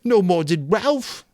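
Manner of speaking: high, loud, slow and whiny; Piggy, wetly. A narrator's reading. Piggy protesting to Jack, high and whiny. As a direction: plead it in a high, wet whine. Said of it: "said Piggy wetly".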